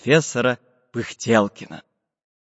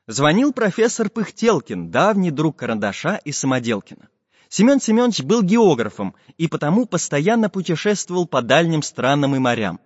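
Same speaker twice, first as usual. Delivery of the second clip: usual speaking voice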